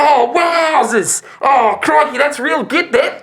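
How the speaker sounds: high, admiring character voice; awestruck shopkeeper. Acted. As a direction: gush over the customer, pitched far up. Shopkeeper marvels at the Guardian.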